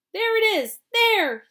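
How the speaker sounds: high, loud shout. A narrator's reading. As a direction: point and shout it out. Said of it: "someone else shouted".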